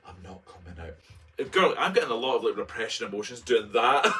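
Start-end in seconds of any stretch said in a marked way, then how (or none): 0.1-1.0 softer, stubborn little refusal. Retold or imitated. Refusing to leave the closet, softer and stubborn. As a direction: mutter it like you're staying put. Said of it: "I'm like".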